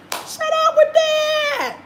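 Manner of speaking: shrieks high and slow, teasing; a drawn-out squealing yell of mock protest; playful high-pitched shout; high joking shout. Real, not acted. Joking reaction to a comment.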